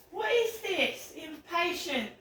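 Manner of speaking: ordinary delivery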